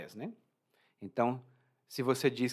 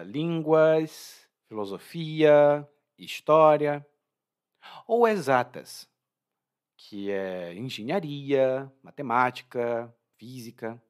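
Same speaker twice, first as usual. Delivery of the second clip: enumeração lenta; 4 dB louder, slower than usual. Shown as a demonstration. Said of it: "Essas duas escolhas são".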